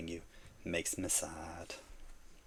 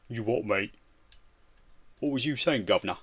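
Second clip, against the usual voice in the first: loud put-on London accent; mock angry Cockney; 2 semitones higher, 6 dB louder than usual. Streamer imitating Bizzle on his doorstep, mock Cockney. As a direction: play a hammed-up Cockney tough. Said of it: "an angry Bizzle at the door".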